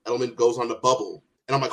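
ordinary delivery

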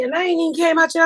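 normal talking voice

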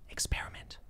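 breathy whisper of the promo code. Acted. Sponsor read ends on a whispered promo code. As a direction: whisper it like a secret.

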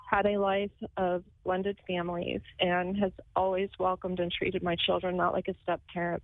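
usual speaking voice

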